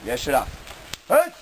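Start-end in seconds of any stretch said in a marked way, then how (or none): 1.1-1.3 shouted dojo commands; shouted drill count. Real, not acted. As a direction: call the count like a sensei. Count for the kiai.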